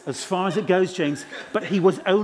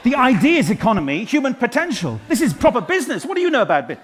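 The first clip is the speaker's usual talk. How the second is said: loud, high-pitched mock outrage; indignant outburst; 6 semitones higher, 7 dB louder than usual. Comedian berating a DJ in the audience, indignant and loud. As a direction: protest loudly, voice pitched right up.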